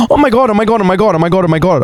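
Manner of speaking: high, rushed oh my God; suddenly remembering something big; breathless high excitement; excited gasp. Real, not acted.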